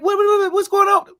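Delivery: very high, alarmed; panicked disbelief. Retold or imitated. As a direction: plead in confused alarm. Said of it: "I'm like".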